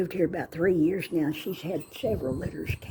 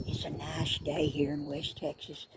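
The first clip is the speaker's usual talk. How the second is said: normal talking voice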